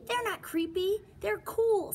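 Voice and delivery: put-on high, loud kid protesting; insistent child. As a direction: protest like an eager little bunny. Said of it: "said Jasper".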